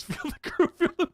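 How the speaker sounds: high, breathy laugh through the words; giggling through a chat quote; laughing while reading. Real, not acted. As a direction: read it out while cracking up.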